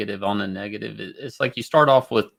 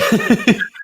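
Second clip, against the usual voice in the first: sudden high, loud laugh; real laugh breaking out at the joke; burst of laughter; 12 semitones higher, 7 dB louder than usual. Real, not acted. Joke about Jesus photographs.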